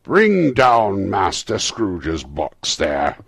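booming, drawn-out shout; terrible voice. A narrator's reading. Schoolmaster ordering the box down from the hall, terrible shout. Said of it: "A terrible voice in the hall cried".

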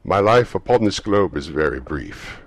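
unearthly voice. Acted. Ghost answering Scrooge.

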